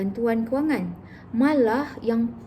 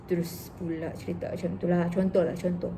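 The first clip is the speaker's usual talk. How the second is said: normal talking voice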